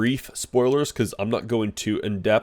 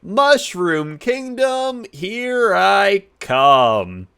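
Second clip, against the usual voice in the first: high, drawn-out heroic proclamation; Mario; 9 semitones higher, 4 dB louder, much slower than usual. Retold or imitated. Reviewer mimicking Mario's trailer line, high and drawn-out. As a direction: stretch each word out big and high. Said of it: "as Mario, he's like".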